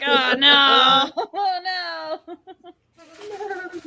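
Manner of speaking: wailed "oh no" breaking into laughter; laughing mock dismay. Admitting a slang habit to a friend, laughing mock dismay.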